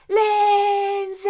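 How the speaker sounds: high, loud shouted name; big shout. Shown as a demonstration. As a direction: shout it as loud as you can. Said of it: "as loud as you can".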